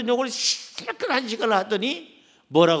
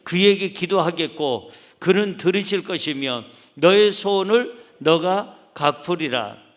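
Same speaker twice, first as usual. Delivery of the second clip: slow, louder reading of the verse aloud; unison scripture reading; 5 dB louder than usual. Acted. 목사가 회중과 함께 성경 구절을 낭독하며 천천히 읽음. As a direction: lead the congregation through the verse together. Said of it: "시작".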